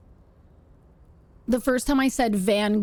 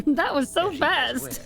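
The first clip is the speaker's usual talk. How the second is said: slow words carried on laughter; laughing; 5 semitones higher, slower than usual. Real, not acted. Streamer quoting the alert that made her tear up, laughing. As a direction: repeat it while cracking up.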